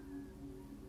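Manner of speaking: long, airy exhale of relief; sigh of relief. Shown as a demonstration. Meditation guide showing listeners neutral on the scale, relieved sigh. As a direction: let the breath fall out softly in relief. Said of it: "Sigh of relief".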